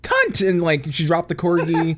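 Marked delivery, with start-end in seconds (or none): 0.0-0.5 very high-pitched mock regal outburst; the Queen. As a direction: squeal it out in a falsetto impression. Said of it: "and she's like".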